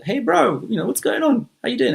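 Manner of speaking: high, loud, eager hello; chummy greeting. Acted. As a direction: greet a stranger like an old pal. Dragon cheerily greets a stranger.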